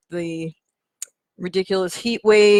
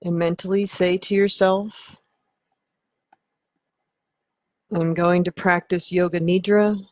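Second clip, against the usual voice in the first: measured, unhurried meditation voice; guided meditation. Acted. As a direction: lead them into relaxation with long pauses. Leads guided relaxation.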